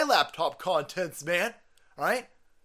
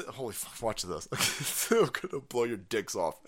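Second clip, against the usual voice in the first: ordinary talk, nothing marked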